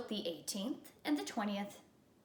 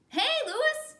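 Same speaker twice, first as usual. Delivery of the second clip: high, loud call across the yard; 7 dB louder than usual. Acted. Calvin calling to the yard teacher, loud high call. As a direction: hail him from a distance. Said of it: "Calvin called".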